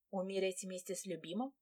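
usual speaking voice

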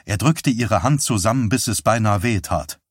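usual speaking voice

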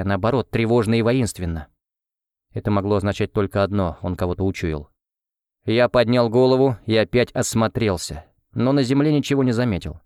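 plain reading voice

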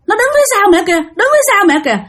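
put-on high, insistent child's voice; four-year-old child. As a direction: warn mom in a high little voice. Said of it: "đứa con của cổ lúc đó là bốn tuổi". (giọng bé bốn tuổi cao, báo mẹ)

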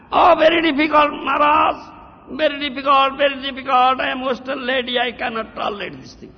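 mock wail, high and sustained; complaining disciple. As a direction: imitate a disciple pleading it's too hard. Mimics a complaining Western disciple.

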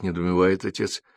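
plain reading voice

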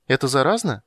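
hopeful. A narrator's reading. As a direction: ask with bright-eyed hope. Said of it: "с надеждой спросил он". (с надеждой)

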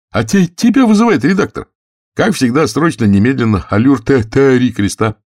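high, loud stutterer reporting in; stuttering Gursky. Acted. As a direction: stumble on the P, then push the sentence out.

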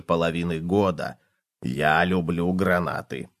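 low, slow, soft voice; dreamy cockroach. A narrator's reading. Cockroach wishes the pot for pomegranate, dreamy.

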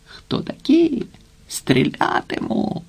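high, drawn-out mock yell, held back in volume; warlike yell. A narrator's reading. Servant behind the gate challenging riders, warlike muffled yell. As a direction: stretch the challenge out in a strained, thin holler. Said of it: "войовниче зарепетував".

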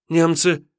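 высоко и громче, выкрикнуть; торопливо согласиться в страхе; испуганный отрок. Acted. Отрок поддакивает. (отрок испуганно выкрикивает)

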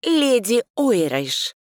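slow, pointedly polite; polite correction. A narrator's reading. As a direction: correct her politely with a smile. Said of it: "исправила с вежливой улыбкой".

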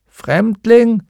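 put-on high old man's question; old man with crutches. A narrator's reading. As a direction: call it out as a surprised old man. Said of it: "antwortete ein alter Mann mit Krücken".

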